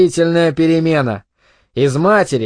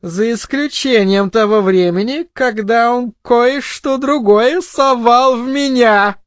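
high woman's voice, slowing into a crude jibe; scornful, snorting; 7 semitones higher than usual. A narrator's reading. Wife crudely scoffs at her husband, scornful. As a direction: drag out the taunt, pitched high. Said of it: "фыркнула Арлетт".

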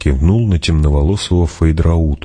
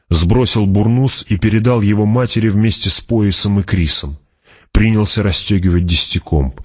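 ordinary delivery, nothing marked

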